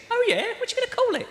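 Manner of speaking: high, mock Cockney mate; a hammed-up Cockney reply; Cockney accent. Cockney mate asking Gary the line's name, high and chirpy.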